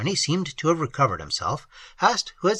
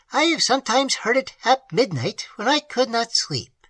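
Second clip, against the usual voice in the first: put-on higher, earnest voice for the old host; higher voice of a plain-spoken country host; old peasant host; 9 semitones higher than usual. A narrator's reading. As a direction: voice the simple host, eager to tell. Peasant host La Voisin speaks.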